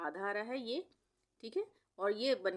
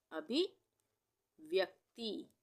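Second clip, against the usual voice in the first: very slow; much slower than usual. Shown as a demonstration. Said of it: "teacher repeats word".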